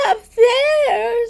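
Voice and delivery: high, loud, wailing little voice; alarmed little creature; Piglet in a panic. A narrator's reading. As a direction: cry it out, frightened and urgent. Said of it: "said Piglet, waving his paw".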